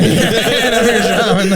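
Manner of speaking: high, drawn-out line through laughter; laughing mock shaman. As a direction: laugh your way slowly through a silly shaman introduction. Joins the shaman bit, laughing.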